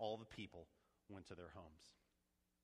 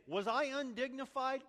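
much higher and louder challenge; David, defiant rhetoric; 13 semitones higher, 8 dB louder than usual. Retold or imitated. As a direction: throw the question back at her. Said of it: "David's saying".

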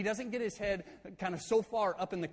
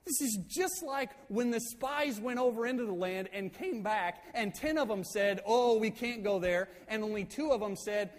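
worked-up retold rant, higher pitch; fired up; 5 semitones higher than usual. Moses scolding tribes by recalling the spies, fired up. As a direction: act out a heated Moses, pitched up. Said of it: "he gets really fired up".

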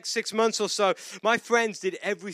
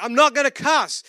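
louder, higher, stubborn retort; headstrong refusal; 8 semitones higher, 8 dB louder than usual. Retold or imitated. Preacher retelling refusing his friends, headstrong. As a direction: imitate your headstrong younger self digging in.

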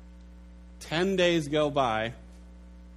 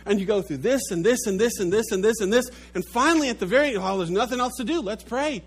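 hurried last resort; 6 semitones higher, 5 dB louder, faster than usual. Acted. Acting a frantic run to prayer.

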